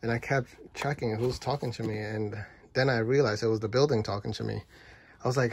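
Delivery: ordinary delivery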